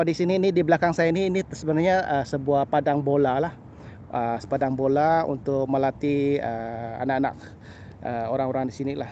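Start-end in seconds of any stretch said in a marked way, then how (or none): none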